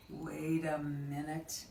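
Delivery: low, slow, soft aside after a gasp; hushed suspense. Acted. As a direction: lean in quietly as if spotting something. Suspense before spotting a tiny bug.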